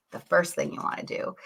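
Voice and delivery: breathy whispered restart; whispered repeat. Shown as a demonstration.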